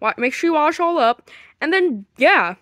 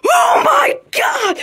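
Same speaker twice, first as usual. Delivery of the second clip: high, slow, crying shout; shocked scream; 11 semitones higher, 4 dB louder, much slower than usual. Acted. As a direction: wail in horror. Officer finds the body.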